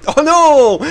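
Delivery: loud, high-pitched cry of protest; cri de dépit. Real, not acted. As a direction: wail it out, long and loud. Son joueur se fait attaquer. (cri de dépit fort et aigu)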